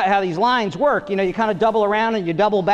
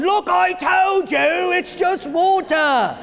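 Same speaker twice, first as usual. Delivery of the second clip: higher, slow, hammed-up scolding voice; mock angry English dad; 8 semitones higher, much slower than usual. Comic imitating the dad shouting at his soaked son, slow and higher. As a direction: drag out each word like a fed-up dad. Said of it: "I'm doing it over and over again".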